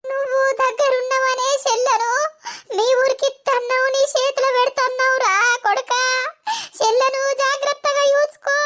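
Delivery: high tearful wail; keening, high-pitched plea; crying. Acted. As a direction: entreat a brother through sobs. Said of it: "ఏడవకు ఓ పిల్ల రజిత".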